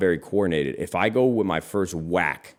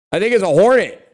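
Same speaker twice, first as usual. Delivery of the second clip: high and loud, still worked up; excited; 11 semitones higher, 8 dB louder than usual. Real, not acted. Identifying the bug for viewers, high excited voice. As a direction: blurt it out, still rattled.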